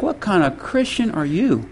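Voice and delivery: soft, affronted retort; muted indignation. Retold or imitated. Pastor offended by the woman's insult, quietly indignant.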